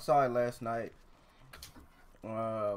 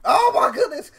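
loud, high exasperation; exasperated shout; 13 semitones higher, 13 dB louder than usual. Real, not acted. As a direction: cry out in disbelief. Exasperated at friend.

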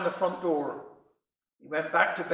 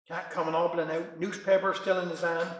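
ordinary delivery

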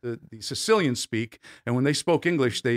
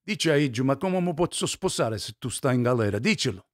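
line spoken in Sicilian dialect. Shown as a demonstration. Actor shows interviewer how he spoke in Sicilian, Sicilian accent. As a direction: say it as a Sicilian would. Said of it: "that's Sicilian".

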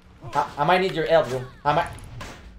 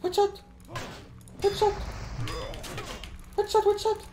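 high excited yelps; excited callout; 14 semitones higher, 4 dB quieter than usual. Real, not acted. Streamer calls out headshots to chat, high and excited. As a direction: yelp each headshot in delight. Calling out headshots mid-fight.

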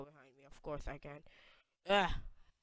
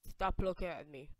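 ordinary talk

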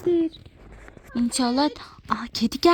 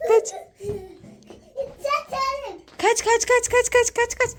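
excited; 5 semitones higher than usual. Pretend. Playful escape in game.

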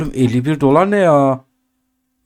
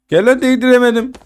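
frustrated yelp; 11 semitones higher than usual. Real, not acted. Eşyayı tutamayınca sinirlenir.

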